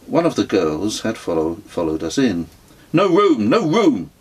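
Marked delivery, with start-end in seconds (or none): 2.9-4.1 high, loud urgent repeat. A narrator's reading. Girl shooing pilgrims from the house, high and urgent. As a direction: press it on them, pleading there's no space. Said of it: "she repeated urgently".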